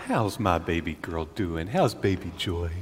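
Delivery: soft, low cooing; baby talk. Acted. Dad greets his sleeping baby girl, soft cooing. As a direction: coo gently to a baby.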